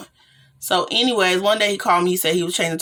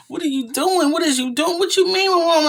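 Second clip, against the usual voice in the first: high, rising panicked questions; flustered high-pitched disbelief; frantic; 9 semitones higher than usual. Retold or imitated. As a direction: fire them off in alarm. Frantic quote to Crazy C on phone.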